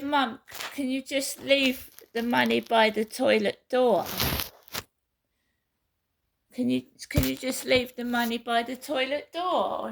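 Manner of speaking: loud, pleading call at a closed door; calling through a door. Retold or imitated. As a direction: shout it to mum through the toilet door.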